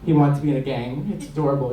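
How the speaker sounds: ordinary delivery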